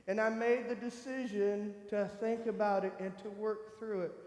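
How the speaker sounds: ordinary delivery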